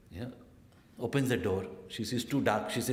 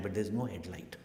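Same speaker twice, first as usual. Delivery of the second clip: ordinary delivery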